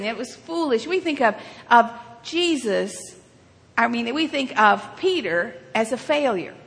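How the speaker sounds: usual speaking voice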